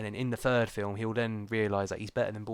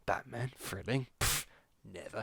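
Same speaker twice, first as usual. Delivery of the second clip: sarcastic; 7 dB quieter than usual. Real, not acted. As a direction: scoff at the idea. Sarcastic aside.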